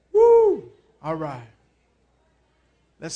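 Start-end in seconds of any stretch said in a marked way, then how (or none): 0.1-0.7 excited high whoop; high woo. Real, not acted. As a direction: let out a loud cheer. Pastor hyping the crowd.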